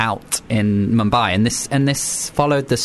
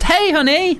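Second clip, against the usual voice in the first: high, chirpy hello; friendly; slower than usual. Retold or imitated. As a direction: greet a friend brightly. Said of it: "this is fine".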